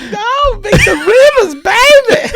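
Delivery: high, loud gushing shout; gushing fan. Retold or imitated. Host greeting Victor Rivers, high loud gushing. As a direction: greet your hero, overjoyed. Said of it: "I was like".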